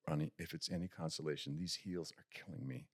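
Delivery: low, soft, close to the ear; compassionate. Retold or imitated. Stuntman consoling the actor softly right near his ear.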